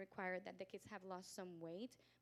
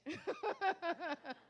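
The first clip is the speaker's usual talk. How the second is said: high, loud burst of laughter; real laugh; 9 semitones higher, 7 dB louder than usual. Real, not acted. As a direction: laugh out at your own joke. Laughing at her own joke.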